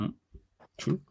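ordinary talk, nothing marked

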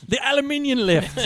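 high, silly British voice; hammed-up British correction; mock British accent. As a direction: relish the British pronunciation. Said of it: "Hate the British".

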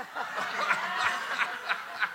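high unvoiced burst of laughter; breathy laugh. Real, not acted. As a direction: let the laugh escape in a breath. Laughs at retold insult.

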